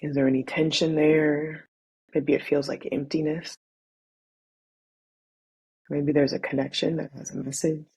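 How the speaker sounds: measured, soothing body-scan guidance; guided meditation. Acted. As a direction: ease them into noticing their breath, unhurried.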